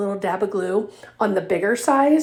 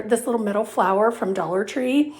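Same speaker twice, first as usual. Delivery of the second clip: ordinary talk, nothing marked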